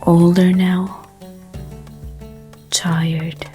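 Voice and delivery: ordinary delivery, nothing marked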